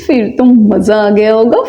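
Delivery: usual speaking voice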